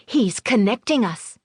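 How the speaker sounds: put-on high, eager child voice; excited child. A narrator's reading. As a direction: explain like a thrilled boy.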